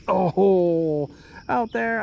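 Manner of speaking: ordinary talk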